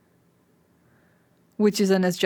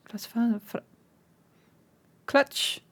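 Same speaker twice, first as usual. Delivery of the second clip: usual speaking voice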